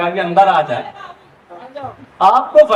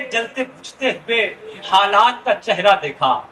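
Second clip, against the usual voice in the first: stately nazm recitation; 5 semitones lower than usual. Acted. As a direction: recite the poem with sorrowful weight. Nazm Sulagta Manzar recited.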